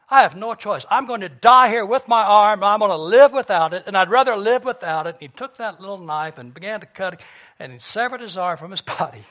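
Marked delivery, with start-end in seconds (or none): 0.1-0.9 loud, higher-pitched desperate resolve; trapped hiker's resolve. Retold or imitated. Hiker alone deciding to cut free, loud resolve. 0.9-5.2 loud, higher-pitched desperate resolve; trapped hiker's resolve. Retold or imitated. Hiker alone deciding to cut free, loud resolve.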